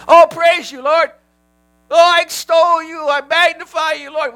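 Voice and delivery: high showy praise voice; hammed-up empty worship; mock praise. Mock worship words said emptily.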